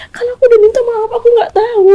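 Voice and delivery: high, fast pleading; wife begging her enraged husband; desperate plea. Retold or imitated. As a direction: beg him to stop, words tumbling out.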